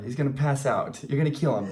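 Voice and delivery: ordinary talk, nothing marked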